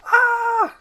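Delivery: high, loud shriek; short panicked yell; terrified scream. Acted. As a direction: scream at a monster. Donkey screams at ogress.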